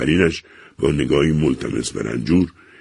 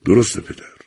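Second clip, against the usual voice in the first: despondent; 6 semitones higher, 3 dB louder than usual. A narrator's reading. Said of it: "با قیافهٔ نومید گفت".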